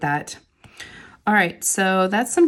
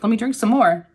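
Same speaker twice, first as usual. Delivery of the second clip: normal talking voice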